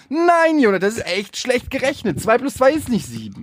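high, blunt mock teacher voice. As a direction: correct the child flatly like a strict teacher. Parodie Lehrerin, blunt correction. (spöttisch gespielte Lehrerin, höher)

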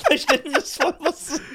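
cracking up mid-sentence; laughing. Real, not acted. Lacht über eigenen Widerspruch.

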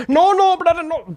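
high pleading "no, no, brother"; imitating a pushy attendant, high and loud; mock attendant. Retold flight attendant scene. (hoch nachgemachter Flugbegleiter)

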